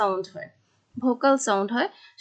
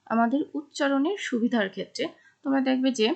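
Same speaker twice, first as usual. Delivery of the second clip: usual speaking voice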